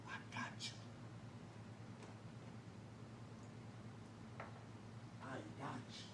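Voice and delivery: hushed, breathy agreement; whisper. Retold or imitated. Kid quietly telling O G he's in. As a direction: whisper it like a secret pact. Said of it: "kid whispering back to O G".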